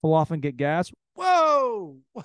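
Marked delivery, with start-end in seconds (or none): 1.1-2.2 high startled whoa, dazed; a sudden head rush hits you; mock dizzy exclamation; surprised whoa.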